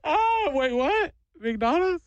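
high, crying voice turning to sudden interest; teary surprise. Retold or imitated. Crying kid hears his dad promise McDonald's, teary surprise. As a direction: sniffly kid perks up mid-sob. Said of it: "I watched this kid immediately go".

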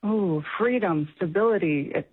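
ordinary talk

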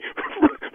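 quiet laugh. Real, not acted. Prankster breaks into laughter.

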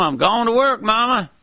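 a man feeling fine heading out; cheery working man. Acted. A healthy man calls to Mama, a hint of cheer.

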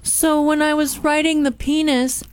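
mock plaintiff voice. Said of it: "she's like".

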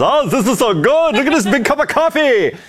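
put-on gushing European tourist accent, pitched high; mock an awestruck foreign visitor's accent. Host imitates European visitors loving American coffee, in excited foreign accents. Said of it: "all these people with the accents".

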